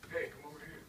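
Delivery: low, soft creepy call. Acted. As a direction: lure them in eerily. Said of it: "what's that creepy voice".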